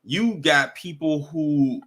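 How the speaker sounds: ordinary talk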